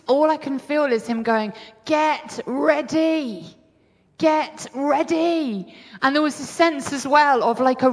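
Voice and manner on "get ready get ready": higher, very slow, drawn-out call; solemn summons. Retold or imitated. Preacher voicing the Lord's call to believers, drawn-out summons. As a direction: stretch each word like a herald's call.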